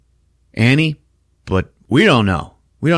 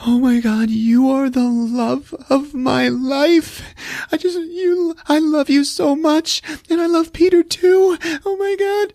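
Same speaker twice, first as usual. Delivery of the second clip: tearful pleading goodbye. Retold or imitated. Mother sobbing her farewell to her husband, high tearful falsetto. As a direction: beg through tears in a high strained voice. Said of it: "sitting there crying".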